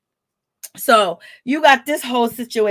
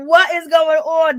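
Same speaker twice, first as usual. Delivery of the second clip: stunned disbelief; 5 semitones higher, 3 dB louder, slower than usual. Retold or imitated. Her reaction to the argument.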